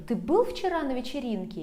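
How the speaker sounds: quick question with a pitch peak on "был"; stress on БЫЛ. Shown as a demonstration. Teacher asking a friend about yesterday, quick question stressing "был". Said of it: "emphasize this word with the intonation".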